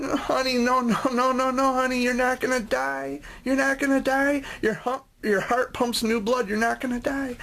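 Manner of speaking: high, gentle reassuring nurse voice; soothing nurse. Retold or imitated. Nurse reassuring the terrified boy, high and gentle. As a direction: coo it up high to calm a panicking child.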